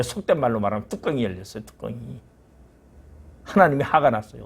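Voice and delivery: ordinary talk, nothing marked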